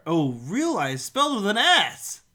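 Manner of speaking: ordinary talk, nothing marked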